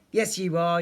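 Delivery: loud, teasing retort; mild yelp. A narrator's reading. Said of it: "yelped Teddy".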